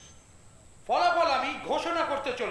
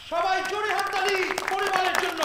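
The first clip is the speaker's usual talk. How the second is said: higher-pitched announcer's call for applause; rallying shout; 5 semitones higher than usual. Acted. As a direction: rouse the crowd from the stage. Announcer rallies crowd to applaud. (দর্শকদের উদ্দেশে চেঁচিয়ে)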